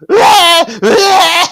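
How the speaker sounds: long, high, loud fake bawl; mock baby cry. Baby Parker butting into the conversation, mock baby cry. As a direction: bawl like a baby for comic effect. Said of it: "Parker really adds to the conversation".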